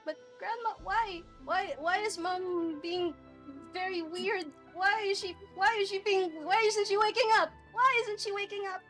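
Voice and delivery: put-on high, upset little girl asking why; rising panic of a small child. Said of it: "Then she says".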